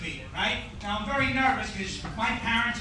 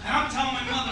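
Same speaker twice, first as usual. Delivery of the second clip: ordinary delivery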